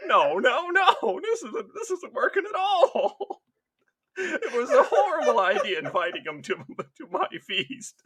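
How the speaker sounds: hammed-up panic, cracking up; laughing mock dismay. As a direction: fake horror through laughter. Host dismayed at feast.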